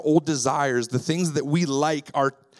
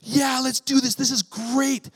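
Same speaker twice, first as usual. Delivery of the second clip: high, chirpy imitation of the daughters; mock kid voice of daughters cheering to clean; eager kids; 8 semitones higher than usual. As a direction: squeak it like excited little girls. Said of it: "They say".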